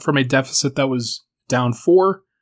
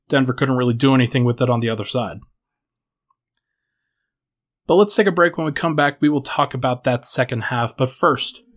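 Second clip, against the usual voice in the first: ordinary talk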